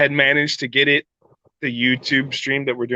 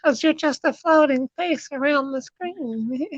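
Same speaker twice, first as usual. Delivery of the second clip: high teasing voice; 10 semitones higher, 3 dB quieter, slower than usual. Pretend. Teasing floating face voice.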